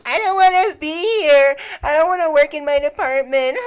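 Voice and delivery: very high, loud, hammed-up crying; mock sobbing wail. Imitating a coworker sobbing about working in their department, mock wail. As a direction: wail it like a mocking impression of a meltdown. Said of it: "Sobbing. She's just sobbing".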